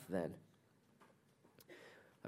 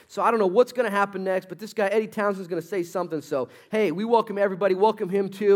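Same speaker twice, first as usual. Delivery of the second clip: MC announcing; 7 semitones higher, 7 dB louder than usual. Retold or imitated. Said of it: "and he said".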